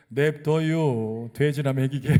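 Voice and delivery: loud, slow Chungcheong retort, drawn out; Chungcheong dialect. Retold or imitated. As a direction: stretch 냅둬요 in a mocking Chungcheong seller's voice. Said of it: "충청도 화법".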